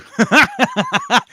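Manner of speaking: loud, high burst of laughter; hearty laugh. Real, not acted. Host picturing Benedict Cumberbatch as Shaka Zulu, laughing hard. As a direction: crack up at an absurd idea. Laughs at absurd casting idea.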